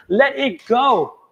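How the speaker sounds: high, loud and slow let it go; drawn-out release call. Shown as a demonstration. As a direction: stretch the words out like a release. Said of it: "repeated release cue".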